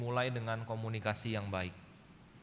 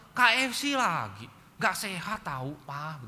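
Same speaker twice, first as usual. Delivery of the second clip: higher, louder nagging mother's voice; exasperated complaint; 6 semitones higher, 6 dB louder, slower than usual. Retold or imitated. Wife scolding husband at KFC parking, exasperated. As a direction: scold the husband about junk food.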